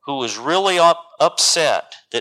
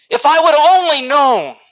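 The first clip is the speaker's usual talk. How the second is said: high, loud cry of regret; anguished regret; 12 semitones higher, 7 dB louder than usual. Acted. Person standing before the Lord, crying out in regret. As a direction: cry it out, much too late.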